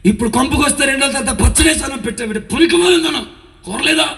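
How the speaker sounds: high, loud, fast furious rant; a retold drunken husband's yell; angry father yelling. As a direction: roar at the wife over the food. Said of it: "కోపగించుకొని బండ బూతులు తిడుతూ".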